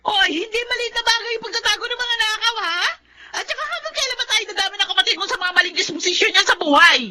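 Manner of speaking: high, strained, near-tearful argument; wife's tearful outburst; upset protest. Acted. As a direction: push back, close to crying. Wife arguing with husband, upset.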